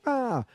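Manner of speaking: short high imitated shout of alarm; mock startled cry. Son seeing his mother collapse, high startled cry.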